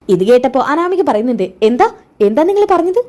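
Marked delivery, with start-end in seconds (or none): 1.6-3.0 a sharp, higher, startled demand; indignant surprise. A narrator's reading. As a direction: catch them out mid-gossip, much higher. Anamika overhears insult, confronts them. (ഞെട്ടലോടെയുള്ള ദേഷ്യം, ഉയർന്ന സ്വരത്തിൽ)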